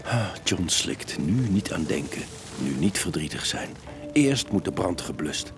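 ordinary delivery

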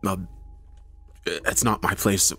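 hesitant, halting lie with false starts; nervous stammering. Acted. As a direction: stumble over the words, pausing nervously. Nervously lying to police officers.

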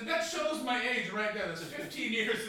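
ordinary talk, nothing marked

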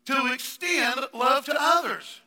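loud, high, fervent preaching; preaching at full force. Acted. Pastor preaches to the congregation, full force. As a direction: press the point home, shouting.

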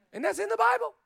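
very high, squeaky disbelief; mock shock. Preacher amazed this is in Scripture, high mock shock. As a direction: send the voice way up as if astonished.

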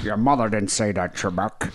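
taunting Scottish film star; Sean Connery. Acted. Contestant needling quiz host Trebek, Sean Connery impression. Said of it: "that's actually not that bad there".

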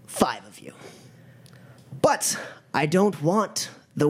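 theatrical initiation speech with weighty pauses; ominous gang leader. Acted. Gang leader beginning a deadly initiation of recruits, ominous oratory. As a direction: start phrases high, let endings sink.

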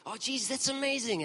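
high, gushing amazement; gushing friend. Retold or imitated. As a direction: squeal it out like a thrilled friend. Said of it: "my wife like this".

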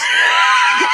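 high shrieking burst of laughter. Real, not acted. Friend shrieks with laughter at the brutal punchline. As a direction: scream with laughter. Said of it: "Oh my God, you're brutal".

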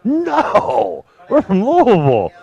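high, put-on voice correcting the barista that they're from Louisville; mock indignant correction. To the barista, a high mock-offended correction. Correcting the coffee shop girl.

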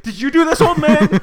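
loud, high hammed-up accusation; accusing yell. Woman confronting an old man on the street, accusing yell. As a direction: scream the accusation at him.

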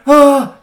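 high, loud yelp of panic; retold startled shriek; alarmed yelp. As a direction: yelp after dialing the wrong number. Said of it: "I went".

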